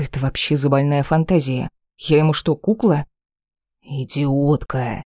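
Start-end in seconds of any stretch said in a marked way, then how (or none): 3.8-5.0 slow, flat verdict; холодная констатация. A narrator's reading. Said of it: "констатирует он". (медленно, с презрением)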